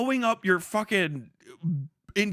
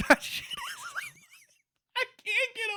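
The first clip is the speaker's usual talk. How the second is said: very high, laughing through the words; cracked-up falsetto giggle; laughing squeal; 3 dB quieter than usual. Real, not acted.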